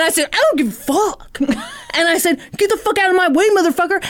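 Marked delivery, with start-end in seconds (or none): 0.3-1.4 high, insistent comeback; lightly hammed-up defiant retort; mock defiance. Gym member insisting back, mock defiant. Acted quarrel in the rant. 2.6-4.0 high-pitched, hammed-up confrontation; mock angry order. Gym member confronting a man, mock angry. Acted quarrel in the rant.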